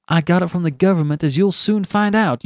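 fast, high, indignant retort; defiant. A narrator's reading. Benjamin defiantly answers the colonel.

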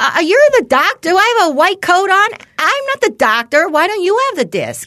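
high, loud, sarcastic tirade; mocking the doctor, loud and high; sarcastic rant. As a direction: lay into him with scorn.

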